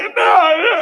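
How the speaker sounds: high wailing mock sob; imitated crying wife. Wife crying as husband keeps going, mock tearful wail. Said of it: "She's, like, crying".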